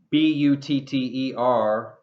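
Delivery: ordinary talk, nothing marked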